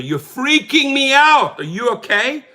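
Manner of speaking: mock panic, high and loud; freaked out. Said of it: "And I told her".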